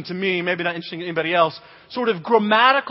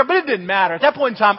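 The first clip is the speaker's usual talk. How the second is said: normal talking voice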